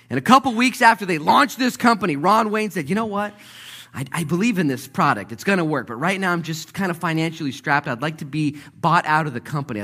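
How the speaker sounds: loud, high, driving sermon delivery; full-force preaching. Acted. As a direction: preach it out, building fast.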